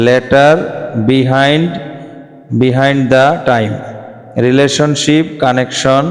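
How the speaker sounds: stretched out word by word; very slow spelled-out pronunciation. Shown as a demonstration. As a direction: say it very slowly so students catch it. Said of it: "শুধু উচ্চারণগুলি দেখিয়ে দিচ্ছি". (খুব ধীরে ধীরে উচ্চারণ)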